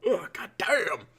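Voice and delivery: real yawn swallowing the words. Real, not acted. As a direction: groan it out through a yawn. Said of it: "I'm yawning so much".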